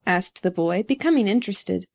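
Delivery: usual speaking voice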